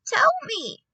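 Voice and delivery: high, loud, sharp demand; snapped. A narrator's reading. Grandmother demanding the answer, snapping. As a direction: snap it out as an order. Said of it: "my grandmother snapped".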